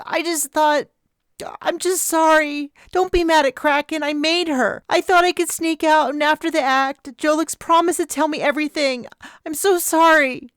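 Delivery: high, tearful rush of apology; sobbing girl. Acted. Chloe begging Liz not to blame Kraken, sobbing. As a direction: beg forgiveness through sobs. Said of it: "she said, sobbing".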